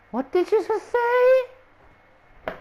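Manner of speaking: very high, drawn-out incredulous question; mock disbelief. Stunned boy to his rude friend, mock disbelief. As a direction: stretch it upward in exaggerated shock. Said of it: "look at him like".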